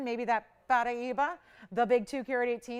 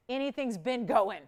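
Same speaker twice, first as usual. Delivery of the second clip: ordinary delivery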